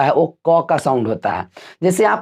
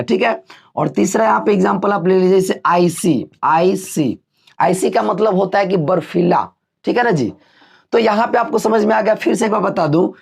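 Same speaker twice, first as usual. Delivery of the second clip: ordinary talk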